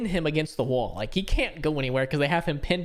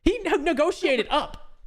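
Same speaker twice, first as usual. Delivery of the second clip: high and slow, in disbelief; can't believe he did it; incredulous; 11 semitones higher, slower than usual. Real, not acted. Friend marvels at buddy's haggling, high and slow. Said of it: "incredulous telling".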